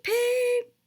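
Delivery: high whistle peep. A narrator's reading. Edward whistling as he arrives, high whistle peep. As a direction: squeak it out like a distant engine whistle. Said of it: "They heard a peep".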